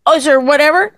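high-pitched mocking questions; imitating fussy husband. Wife mimicking husband micromanaging her cooking, high questioning voice. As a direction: pitch up each question in mockery. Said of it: "You're like".

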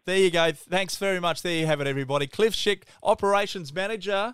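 cheery announcer farewell to listeners; podcast outro. Acted. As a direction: wrap up the show like a radio host selling the brand. Podcast sign-off over music.

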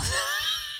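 high, soft laugh; a quiet, high-pitched burst of laughter. Real, not acted. Listener laughs after teasing.

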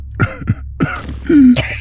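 high, breathy laugh of a wounded man; wounded Sutter laughs with his friend; pained laugh. Acted. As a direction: laugh through the pain. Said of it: "His laugh turning into a".

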